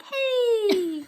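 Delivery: high-pitched drawn-out playful greeting; mom and daughter voice. Acted. Voicing the pictured mom and daughter, high playful greeting. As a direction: squeal a long, high hello. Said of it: "at the mom and daughter like".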